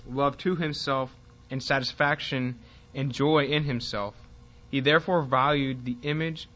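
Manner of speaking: loud, emphatic read-aloud, phrase by phrase; reading a passage aloud. Real, not acted. Teacher reading an Edwards passage to the class, emphatic read-aloud. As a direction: read it out firmly to the room.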